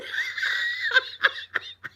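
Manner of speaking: high breathy cackle; high laugh. Real, not acted. Storyteller amused at her own rage, high laugh.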